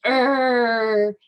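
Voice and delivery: loud, drawn-out "err" growl; bear growl. Acted. Teacher showing what a bear says, playful growl. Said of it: "A bear says".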